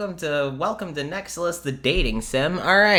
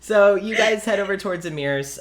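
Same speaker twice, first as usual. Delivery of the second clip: ordinary talk, nothing marked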